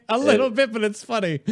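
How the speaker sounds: high, giggly words through laughter; laughing. Real, not acted. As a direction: laugh while answering. Laughing at friend's robotic phone voice.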